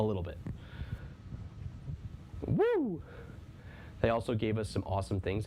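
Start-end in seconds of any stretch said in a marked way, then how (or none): 2.5-3.0 high woo. Real, not acted. Cheering in chat.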